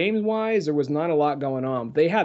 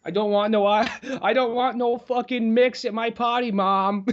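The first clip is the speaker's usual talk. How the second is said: mock whiny kid; 6 semitones higher than usual. Mocking kid complaining to mom.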